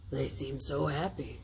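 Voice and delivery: soft, hushed Scrooge; softly. A narrator's reading. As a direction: say it gently, moved. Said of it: "said Scrooge softly".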